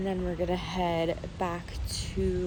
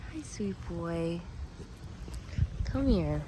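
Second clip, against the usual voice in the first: tender pet talk; 7 dB quieter, slower than usual. Real, not acted. Greeting the dog softly.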